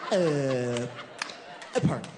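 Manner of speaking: low, hesitant mock mumble; imitating the boyfriend. Comic mimics the boyfriend's answer, low and halting. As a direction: drop low and stall like the shy guy. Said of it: "He's like".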